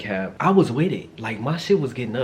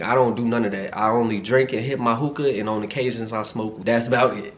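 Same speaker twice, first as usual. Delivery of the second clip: normal talking voice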